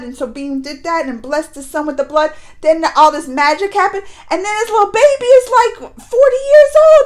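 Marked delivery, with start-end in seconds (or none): 4.3-7.1 high, fast, amazed; pitched-up astonished rush; incredulous. Real, not acted. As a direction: blurt it out in disbelief. Shocked at baby aging.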